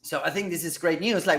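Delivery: ordinary talk, nothing marked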